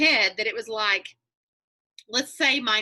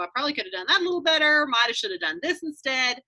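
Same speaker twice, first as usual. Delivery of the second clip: rushed regret; 4 semitones higher, faster than usual. Retold or imitated. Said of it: "where you go".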